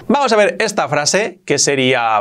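usual speaking voice

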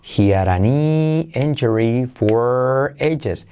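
very slow, drawn-out model sentence; slow, careful pronunciation drill; repeat-after-me model. Shown as a demonstration. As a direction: stretch each word for learners to repeat. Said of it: "Repite conmigo".

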